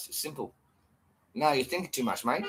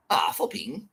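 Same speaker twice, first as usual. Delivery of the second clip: ordinary talk, nothing marked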